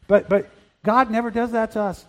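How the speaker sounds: ordinary delivery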